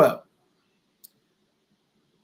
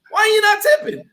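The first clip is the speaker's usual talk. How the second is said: much higher, indignant mock questioning; incredulous challenge; 13 semitones higher than usual. Acting out the Reservoir Dogs tipping argument, incredulous. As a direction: challenge it in high-pitched disbelief.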